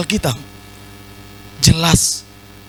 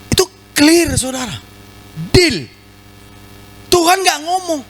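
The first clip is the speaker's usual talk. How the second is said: ordinary talk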